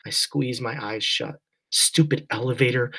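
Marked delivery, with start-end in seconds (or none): none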